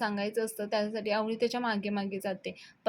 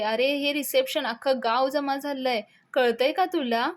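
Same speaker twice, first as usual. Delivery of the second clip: raised, higher voice of annoyance; exasperated; 4 semitones higher, 5 dB louder than usual. A narrator's reading. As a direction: scold him as the narrated woman. Woman continues irritated quarrel. (चिडून जाब विचारणे)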